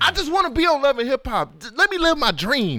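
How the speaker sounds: high-pitched, playful plea; mock pleading. Host begging co-host to let the dream live, mock pleading.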